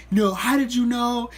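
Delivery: defensive. Retold or imitated. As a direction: push back hard in mock suspicion. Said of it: "I was all defensive".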